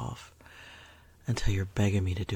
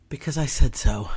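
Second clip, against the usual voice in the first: louder, domineering retort; bossy command; 10 dB louder than usual. Acted. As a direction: lay down the law.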